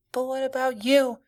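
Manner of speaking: put-on small frightened child; little girl voice. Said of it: "the little girl asks".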